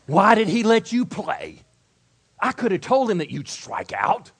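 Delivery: hammed-up taunting teammate; jeering teammate. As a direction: sneer it at the batter. Teammate jeering after strikeout.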